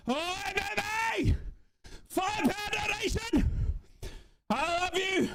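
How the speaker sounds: high, drawn-out grateful shout; excited donor shout-out. Real, not acted. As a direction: holler thanks to the donor, still buzzing. Thanking a donor amid celebration.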